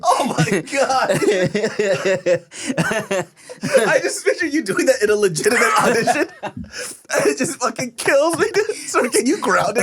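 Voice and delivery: loud, high words through real laughter; wheezing with laughter, pitch way up; cracking up. Real, not acted. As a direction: talk while helplessly laughing. Helpless laughter at friend's acting.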